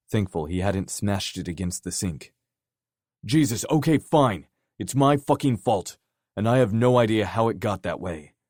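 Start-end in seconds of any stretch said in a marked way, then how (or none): 3.2-8.3 loud, higher exasperated outburst. Acted. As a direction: throw up your hands and shout. Dare explodes in frustration.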